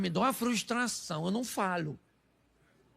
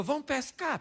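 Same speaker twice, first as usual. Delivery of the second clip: higher, unforced invitation; voicing Jesus; 6 semitones higher, 4 dB quieter than usual. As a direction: pitch it up as a playful command. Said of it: "Jesus falou".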